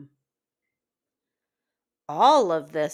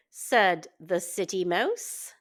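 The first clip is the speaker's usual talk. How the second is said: ordinary delivery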